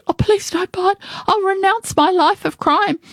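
very high, desperate plea; begging. A narrator's reading. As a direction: plead for mercy in a high voice. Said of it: "Another begged".

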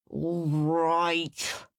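drawn-out grudging snarl. A narrator's reading. Henry giving in to chores, snarled. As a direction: snarl it as you give in. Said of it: "snarled Henry".

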